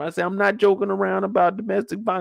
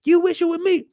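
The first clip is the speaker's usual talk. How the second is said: high, mocking comeback; high taunt; 9 semitones higher than usual. Imitating Wanda clapping back, high and mocking. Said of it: "she going back to".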